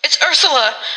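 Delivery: high, tense, horrified utterance; through clenched teeth. A narrator's reading. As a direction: force it out between your teeth. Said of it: "said Tabitha from between her teeth".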